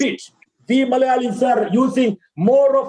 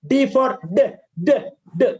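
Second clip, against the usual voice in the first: normal talking voice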